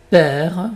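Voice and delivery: père with a wide open è. Shown as a demonstration. Teacher gives example of accent grave, open è in père. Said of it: "la bouche est beaucoup plus ouverte".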